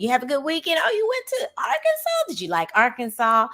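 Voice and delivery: high-pitched, chatty teacher greeting; acted eager interest. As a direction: pitch leaps up in delighted interest. Acted friendly chat with a student.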